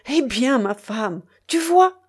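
high, loud, triumphant crowing; gleeful cry leaping up at the end; overjoyed exclamation. A narrator's reading. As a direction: crow to your wife, bursting with joy. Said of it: "s'écria le maître de poste qui ne se tenait pas de joie".

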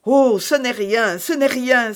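high, loud exclamation; crying out. A narrator's reading. Milady dismissing her bruised wrists to Felton, crying out. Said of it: "s'écria Milady".

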